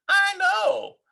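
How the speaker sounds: high, loud impatient shout; child shouting. A narrator's reading. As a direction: shout it like an eager kid. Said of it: "cried Froggy".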